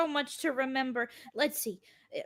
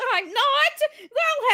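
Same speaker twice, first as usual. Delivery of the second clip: indignant surprise; 10 semitones higher, 6 dB louder, much slower than usual. Acted. Irma indignant at being exposed.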